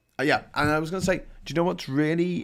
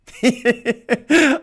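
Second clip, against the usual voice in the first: a loud, high real laugh; burst of laughter; 11 semitones higher, 8 dB louder than usual. Real, not acted. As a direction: crack up at yourself. Laughs at own rant.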